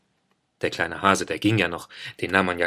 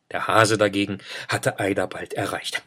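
ordinary delivery

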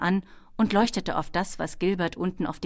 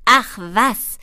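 loud, higher kobold scoff; dismissive kobold; 5 semitones higher, 11 dB louder than usual. A narrator's reading. Kobold waves away Ben's worry, loudly dismissive. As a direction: brush the objection off loudly. Kobold brushes off boy's objection.

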